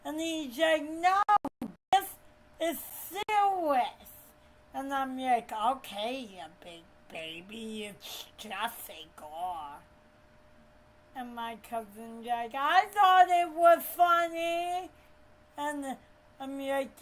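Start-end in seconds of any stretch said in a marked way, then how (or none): 0.9-4.1 higher, louder mock protest; indignant protest. Retold friend's angry protest. 5.5-9.8 low, soft retold put-down; dismissive. 12.5-14.9 high, loud cousin voice; pitched-up, loud mimicry of a cousin; imitating cousin.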